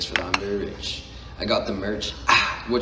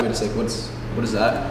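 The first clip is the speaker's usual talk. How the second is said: ordinary talk, nothing marked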